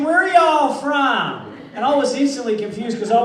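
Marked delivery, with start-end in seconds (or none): 0.0-1.5 slow, high, loud mock Texan drawl; Texan accent. Texan asks the Australian where he's from, slow mock drawl. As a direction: stretch it out like a puzzled Texan. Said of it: "He said".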